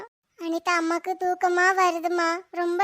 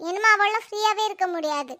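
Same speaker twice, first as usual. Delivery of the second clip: usual speaking voice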